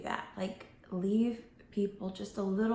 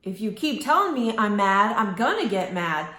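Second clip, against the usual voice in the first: irritated warning; 7 dB louder than usual. Retold or imitated. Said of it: "you're just like".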